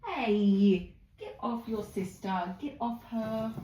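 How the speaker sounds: scolding the pets. Real, not acted. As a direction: stop one pig bullying her sister. Scolding one guinea pig.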